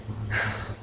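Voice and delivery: soft breathy sigh; short, hushed exhale. A narrator's reading. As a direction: let out a quiet sigh. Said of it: "Then she sighed".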